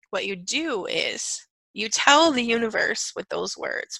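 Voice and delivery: ordinary talk, nothing marked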